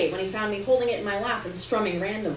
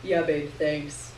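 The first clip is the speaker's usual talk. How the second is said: Terrence voice; 5 semitones lower, slower than usual. A narrator's reading. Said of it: "Terrence answers".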